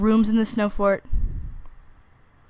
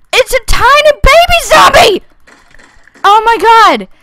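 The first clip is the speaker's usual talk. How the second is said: shocked high shriek; 12 dB louder than usual. Real, not acted. Shocked by baby zombie.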